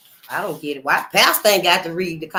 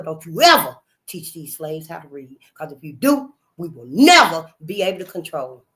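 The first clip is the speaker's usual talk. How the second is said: enraged master; 5 semitones lower, 4 dB louder than usual. Retold or imitated. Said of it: "He was just enraged".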